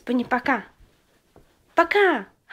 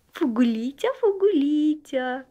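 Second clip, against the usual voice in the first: usual speaking voice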